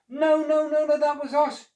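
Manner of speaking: very high, loud frantic shriek. A narrator's reading. Monkeys shrieking at Noah, high and loud. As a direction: shriek to correct him. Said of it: "shrieked the monkeys".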